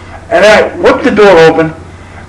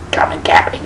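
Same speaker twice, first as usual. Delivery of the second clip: much lower, whispered and soft; seductive whisper; 14 semitones lower, 7 dB quieter than usual. Retold or imitated. Twinkle inviting him into bed, in a low whisper.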